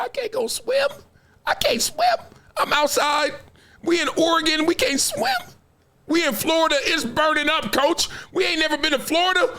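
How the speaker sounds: put-on high-pitched whining child; hammed-up kid protesting to the coach, building to a shout; complaining kid. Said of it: "when I was that kid".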